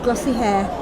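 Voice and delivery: ordinary delivery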